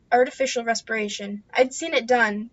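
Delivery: usual speaking voice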